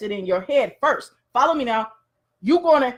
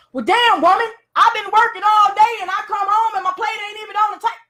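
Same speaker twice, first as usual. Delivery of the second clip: angry husband; 8 semitones higher than usual. Acted. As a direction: chew her out like a fed-up husband. Husband yelling at wife.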